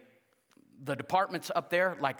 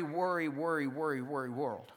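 worry, worry repeated, sinking lower and softer; fading repetition; 6 semitones lower, 5 dB quieter than usual. Shown as a demonstration. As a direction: let each worry sink deeper. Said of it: "repeating worry for effect".

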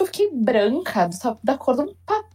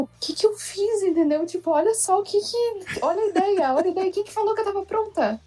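higher, panicked inner voice, replaying the shock; retold panic; 7 semitones higher than usual. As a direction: voice the dread of "what have I done". Retold panic after positive test. (pânico relembrado, voz mais aguda)

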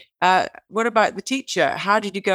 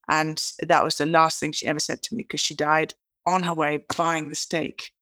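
normal talking voice

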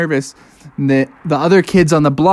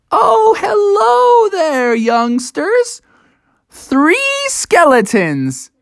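high, drawn-out welcome; householder greeting youngsters; much slower than usual. Acted. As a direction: stretch the greeting out, high-pitched.

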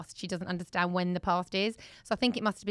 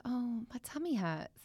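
put-on child's complaint; 3 semitones higher, 8 dB quieter, slower than usual. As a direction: quietly voice a child with tummy ache. Retelling child at bedtime.

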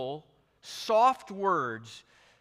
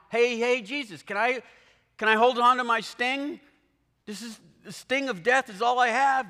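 higher, louder, whiny Death bargaining; pleading Grim Reaper; 8 semitones higher, 5 dB louder than usual. Pretend. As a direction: play Death begging to keep his sting. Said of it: "the Grim Reaper here is begging".

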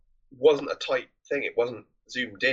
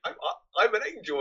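lightly voiced Clarence; 10 semitones higher than usual. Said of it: "listening to Clarence go on".

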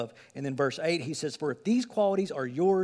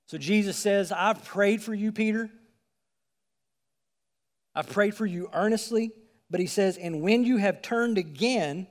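fervent preaching; 6 semitones higher, 6 dB louder than usual. Acted. Preaching at full force.

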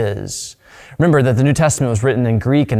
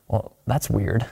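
softly voiced, mock-puzzled aside; puzzled reaction; 3 semitones lower, 7 dB quieter, slower than usual. Modern hearers reacting to an old controversy, quietly puzzled. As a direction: drop the volume, as if quietly taken aback. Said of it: "we hear that and we're like".

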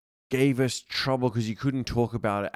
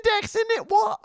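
very high hammed-up British voice; excitable high-pitched cartoon British; mock British accent. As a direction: squeak it out as a parody Brit. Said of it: "We used to be so British".

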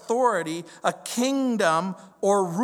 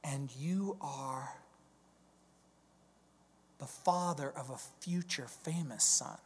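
whisper; 4 semitones lower, 11 dB quieter than usual. Shown as a demonstration. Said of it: "whispered revelation".

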